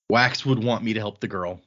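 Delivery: ordinary talk, nothing marked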